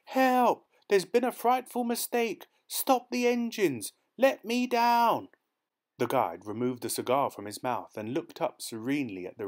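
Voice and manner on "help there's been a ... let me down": high, loud, slow desperate pleading; frantic shouting. A narrator's reading. Dangling youth begs the men below, high and slow. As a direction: beg them to stop, terrified. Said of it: "he shouted".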